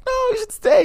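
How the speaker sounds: very high, whiny plea; high whiny please stay; whiny pleading. Retold or imitated. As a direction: beg her to stay, pathetically. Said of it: "I'm like".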